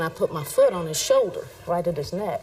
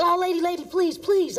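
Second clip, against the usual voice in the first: higher, whiny begging voice; pleading suspect; 12 semitones higher than usual. Retold or imitated. Officer retelling the pinned suspect's begging, higher and whiny. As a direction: beg like the man pinned under your boot. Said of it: "And he said".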